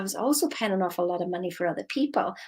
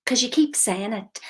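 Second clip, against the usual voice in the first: usual speaking voice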